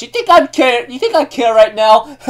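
high-pitched, playful taunt; mock defiance. Jinbei brushing off Big Mom's threat, mock defiance. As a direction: toss it back high and cheeky.

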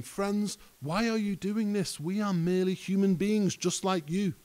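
mild shout from a scripture reading; urgent calling out. Acted. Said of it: "ran out among the people shouting".

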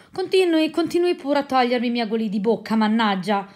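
loud, irritated outburst; a loud cry of irritation; exasperated exclamation. Acted. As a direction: snap back, fed up with the interruptions. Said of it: "esclamò Colonnello".

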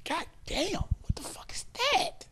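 high, hushed whisper of disgust. Retold or imitated. Smelling something foul during sex, high disgusted whisper. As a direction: gasp it under your breath at the smell. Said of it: "I was like".

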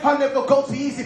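ordinary delivery

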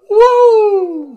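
shrill, very high, loud woo; Ric Flair woo. Retold or imitated. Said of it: "We're gonna have a woo-off soon".

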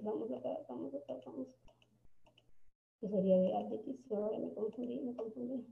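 very soft, half-voiced aside; muttering to herself. Real, not acted. Teacher losing her place in the slides, muttering. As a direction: murmur it to yourself while searching. Flustered self-talk while finding her place.

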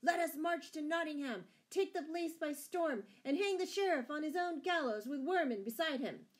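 loud, high-pitched call to arms; outlaws' shout. A narrator's reading. Outlaws urging a march on Nottingham, shouting. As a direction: rouse the band with a sustained shout. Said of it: "shouted the outlaws".

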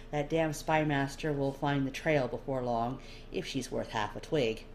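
ordinary delivery

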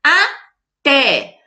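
"Até" split in two, punching "té"; strong last syllable. Shown as a demonstration. Teacher demonstrating to pupils, "Até" split with stress on "té". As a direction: separate the syllables of "Até", land hard on the last. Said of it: "Qual que foi a sílaba forte".

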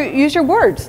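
ordinary delivery

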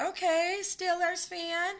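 high, drawn-out teasing okay; a mocking sing-song Okay; teasing sass. Real, not acted. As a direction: tease the Steelers fan playfully. Teasing a viewer.

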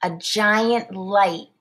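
ordinary delivery, nothing marked

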